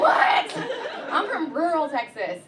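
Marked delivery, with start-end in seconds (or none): 0.0-0.5 high shrieked what; baffled shriek. Retold or imitated. A comedian mock-baffled at a friend, squealing high. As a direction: squeal it in disbelief. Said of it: "I'm like".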